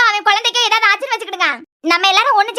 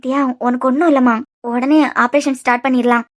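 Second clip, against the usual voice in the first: reassuring; 7 semitones lower than usual. Acted. Calming Diya before surgery.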